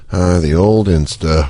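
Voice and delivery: put-on low, slow sigh over the old Insta; wistful tone. Shown as a demonstration. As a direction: say it slowly, down low, as if reminiscing. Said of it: "all about tone and how you say that".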